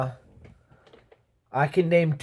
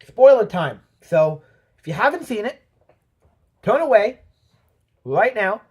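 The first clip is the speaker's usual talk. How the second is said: loud announcement; 5 semitones higher, 6 dB louder than usual. Real, not acted. Announcing spoilers to viewers.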